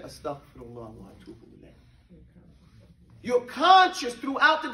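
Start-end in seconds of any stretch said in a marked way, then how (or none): 0.0-1.7 low, soft murmur to yourself; quiet muttered. Retold or imitated. Teacher showing the private istighfar, muttered low. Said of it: "You said".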